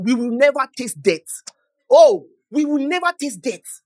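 builds from strong to full shout on never taste death; a rising cry of conviction; rising preaching shout. Real, not acted. Preacher repeats line at rising force.

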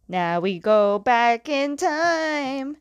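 playful, slow scene-change call; mock-dramatic flashback. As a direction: stretch it out like a time warp. Playful flashback transition announcement.

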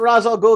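Ra's al Ghul said RAHZ al GOOL; childhood pronunciation. Shown as a demonstration. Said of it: "the way it was pronounced when I was a kid".

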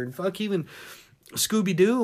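ordinary talk

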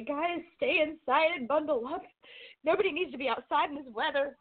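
a hint of a grandma warning the kids; fussing grandmother. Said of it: "she'll say".

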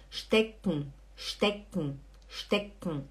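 "stecken" as SHTEK-n, no vowel in the ending; swallowed final E. Shown as a demonstration. Teacher asking students to pay attention, swallowed ending. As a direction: repeat "stecken" with the last E dropped. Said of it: "É como se o E você não falasse".

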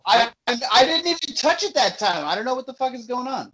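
high and frustrated, voice jumping up; baffled and exasperated outburst. Real, not acted. Frustrated about broken mic.

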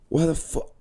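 lightly imitated British exclamation; British accent. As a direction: quote it in a put-on British voice. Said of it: "why am I doing British?".

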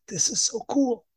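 a touch of wide-eyed wonder; lightly awed. Retold or imitated. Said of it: "But I was just like".

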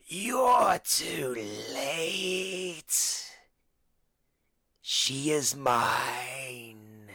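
put-on slow, drawn-out otherworldly voice from a child; a possessing spirit speaking through a little girl, very slowly; unearthly voice. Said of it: "you hear this otherworldly voice".

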